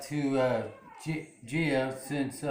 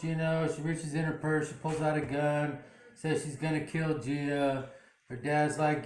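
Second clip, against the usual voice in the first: ordinary talk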